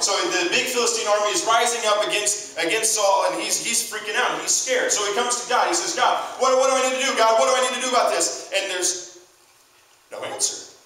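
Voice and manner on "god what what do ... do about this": high-pitched, urgent appeal; frantic plea. Acted. Scared Saul begging God for direction, frantic plea. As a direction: plead urgently, pitched well up.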